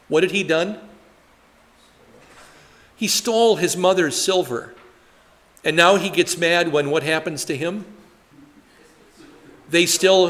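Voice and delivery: ordinary talk, nothing marked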